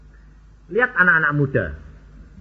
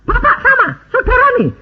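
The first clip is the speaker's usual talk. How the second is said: high imitation of a stunned seller; astonished question; 6 dB louder, faster than usual. Car seller realising who the customer is, astonished. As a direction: pitch shoots up in amazed disbelief.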